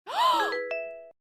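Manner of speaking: sharp high gasp of surprise; shocked gasp. Real, not acted. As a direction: gasp in delight. Gift notification from viewer Bing.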